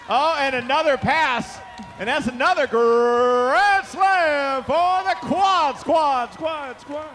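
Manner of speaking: loud, high, drawn-out grand slam call; excited shout. Real, not acted. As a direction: roar it to the crowd. Grand slam call at game end.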